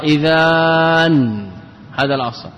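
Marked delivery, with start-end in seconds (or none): 0.0-1.5 إذان with a drawn-out aa, then a held n; with tanween added. Shown as a demonstration. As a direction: stretch إذان slowly into a long final n. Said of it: "لما نونت صار". (إذان بمد الألف وتنوين)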